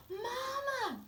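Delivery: put-on high, pleading child's call. Boy calling his mother about school, high child voice. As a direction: call Mama like a small boy.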